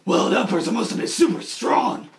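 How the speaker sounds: low, gruff Batman; mock Batman voice; Batman growl. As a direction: growl like the hero. Batman dialogue.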